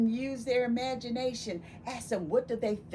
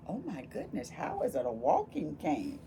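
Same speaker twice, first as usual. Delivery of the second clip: plain reading voice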